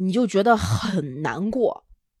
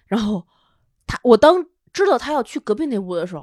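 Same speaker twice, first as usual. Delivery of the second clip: ordinary delivery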